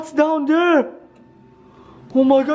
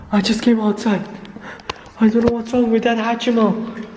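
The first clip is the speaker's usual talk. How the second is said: ordinary delivery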